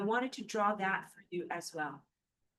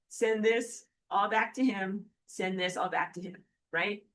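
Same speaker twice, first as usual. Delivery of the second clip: normal talking voice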